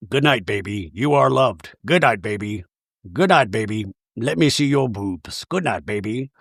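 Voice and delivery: very low, creepy toy-message voice; Jared. Retold or imitated. Toy unicorn repeating good night in bed, low and creepy. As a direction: imitate a talking toy droning low. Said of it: "Is that Jared's voice?".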